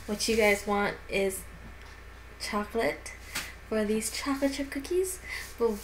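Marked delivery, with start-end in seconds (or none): none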